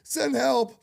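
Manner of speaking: real cry-laughing outburst; laughing through tears. Real, not acted. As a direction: blurt it out mid-sob-laugh. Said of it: "that laugh helped".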